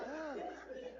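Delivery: exaggerated high startled gasp; big sucked-in breath of mock fright; demonstrated gasp. Shown as a demonstration. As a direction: show them a classic scare gasp. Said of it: "this is like".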